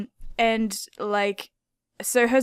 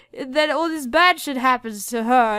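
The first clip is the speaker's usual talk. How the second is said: normal talking voice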